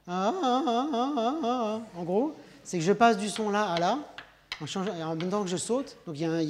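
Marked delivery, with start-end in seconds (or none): 0.1-2.0 high yodeling ahs jumping between registers; Swiss yodel. Shown as a demonstration. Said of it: "les tyroliennes suisses".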